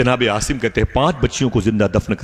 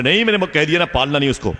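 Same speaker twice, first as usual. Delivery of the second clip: insistent refusal; 7 semitones higher, faster than usual. Retold or imitated. Husband insists to crying wife.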